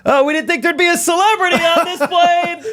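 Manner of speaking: very high-pitched, hammed-up amazement; mock starstruck gushing. Friends teasing him aboard a plane, mock starstruck. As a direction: announce it teasingly for the whole cabin.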